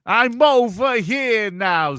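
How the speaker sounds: high, slow put-on singer voice. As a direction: croon it like a reggae pop hook. Shaggy-style song riff.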